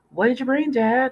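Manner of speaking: put-on high, eager kid voice; excited kid. A narrator's reading. Boys asking their dad what food he brought. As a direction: bounce with excitement. Kids greeting father, excited.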